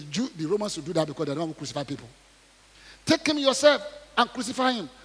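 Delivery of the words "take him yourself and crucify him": raised voice. Acted.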